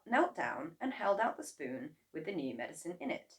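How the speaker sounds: plain reading voice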